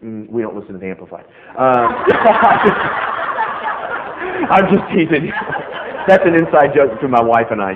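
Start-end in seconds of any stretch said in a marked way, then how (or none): 1.7-4.4 loud, high burst of laughter. Real, not acted. Preacher teasing the congregation, big laugh. 4.5-7.8 louder, talking through laughter; laughing. Real, not acted. Preacher explains inside joke with his wife, laughing.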